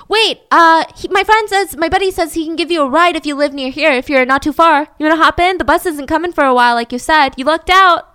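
high, loud coaxing offer; Kenny coaxing Jane into the car, high and loud; persuasive nice guy. Retold or imitated. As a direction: sell the ride casually.

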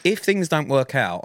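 ordinary talk

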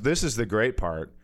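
ordinary delivery

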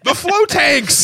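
high, drawn-out cry of alarm; mock panic. Soldiers crying out at floating tanks, mock panic. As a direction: wail it in hammed-up dread.

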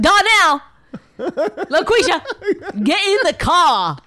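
high, loud name-calling with stretched syllables; hammed-up bossy mother; single mom impression. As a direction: holler for the kids across a distance. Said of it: "single mom with two kids".

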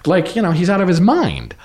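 ordinary talk, nothing marked

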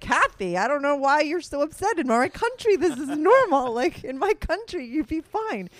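high, loud mock German accent; a lightly hammed German accent; German friend impression. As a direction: play the German friend, smug and amused by her worry. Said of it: "And he'd be like".

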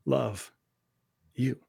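soft, slow, with long pauses between words; God's quiet words, soft and slow; hushed voice of God. Retold or imitated. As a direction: let each word land gently, almost a breath. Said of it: "he says".

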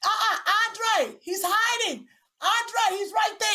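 high, urgent tattling call. Real, not acted. Mother telling Andre where the boy hides, calling out.

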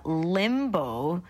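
usual speaking voice

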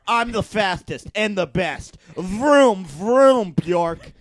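loud, high, slow hammed-up race car driver; cocky race car driver. Hammed-up race car driver introducing himself to the room, loud and high. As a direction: boast like a swaggering racer. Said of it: "Scott Thurston, race car driver".

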